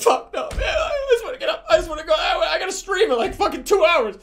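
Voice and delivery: high, loud, half-laughing wail; panicked high wail about missing the stream; frantic whining. Retold or imitated. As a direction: whine like you're desperate to get out. Trapped in the dream, begging.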